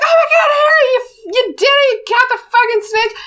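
high, shouted cheer; excited shouting fans. Retold or imitated. Gryffindors running to Harry after the win, high shouted cheer. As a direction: yell it like a crowd mobbing the winner. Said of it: "come running and they're like".

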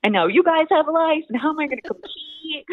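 high-pitched mock panic to dad; retold meltdown. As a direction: replay your panicked wail, half in fun. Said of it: "I was literally having a meltdown".

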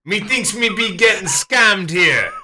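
high, slow, hammed-up Jamaican; badly faked Jamaican accent. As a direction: slowly declare it in mock Jamaican. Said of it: "came here from Jamaica".

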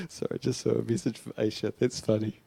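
very soft, low, amused aside; suppressed laughter. Real, not acted. Preacher distracted by a funny message, suppressed laughter. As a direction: apologize quietly through real giggles.

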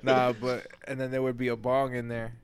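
normal talking voice

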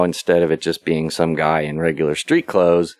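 normal talking voice

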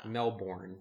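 Melbourne with a full second syllable, like "born"; mispronounced Melbourne. Shown as a demonstration. Host showing how others misread the city, mispronounced Melbourne as "mel-born". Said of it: "is how they pronounce it".

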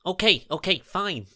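high, loud, hurried giving in; flustered agreement. Acted. Jasper gives in to Gethin's order, flustered. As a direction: give in, all in a fluster.

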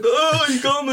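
high, sheepish gotcha; mock busted. Mimicking the busted man to his mates, high sheepish squeak. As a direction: squeak it like you've just been caught out. Imitating caught man again.

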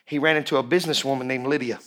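usual speaking voice

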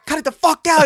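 high and loud cut it out; hammed-up furious older brother; imitating a yelling brother; mock angry shout.

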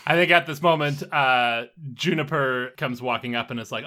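ordinary talk, nothing marked

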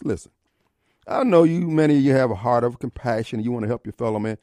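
ordinary talk, nothing marked

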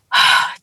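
an audible breath, acting out the hiker's; deep breath. Struggling teammate readying for the prayer, deep breath. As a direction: imitate one big breath. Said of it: "took a breath".

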